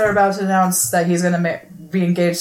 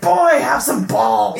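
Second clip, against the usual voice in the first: mock scolding shout; 5 semitones higher, 4 dB louder, slower than usual. [name] scolding timid Gustav.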